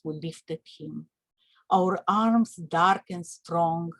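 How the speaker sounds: grave, unhurried recitation of a lament; solemn poem reading. Acted. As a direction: read it as an elegy, pausing after each image.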